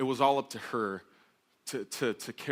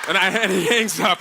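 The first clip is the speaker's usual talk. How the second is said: ordinary talk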